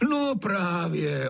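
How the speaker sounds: slow, high, drawn-out agreement; wheedling comeback. A narrator's reading. Master turns the saying round, high slow wheedling. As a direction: seize on the words and stretch them. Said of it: "obrací to Trautenberg".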